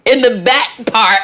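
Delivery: boastful mock artist, slowing at the end; bragging artist voice. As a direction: brag like a wannabe name-dropper.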